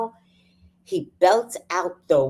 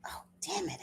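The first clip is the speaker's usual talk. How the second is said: whispered curse; 17 dB quieter, faster than usual. Real, not acted. Annoyed at missing boxes.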